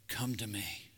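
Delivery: whispered tender invitation; gentle whisper. Retold or imitated. Jesus calling the weary to him, a tender whisper. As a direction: breathe it softly, an invitation.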